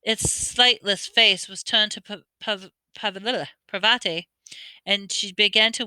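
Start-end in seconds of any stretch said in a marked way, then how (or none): none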